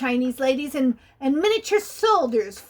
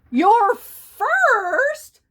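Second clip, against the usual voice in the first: put-on chuckling old reindeer; 9 semitones higher, 7 dB louder than usual. A narrator's reading. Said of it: "the old reindeer chuckled".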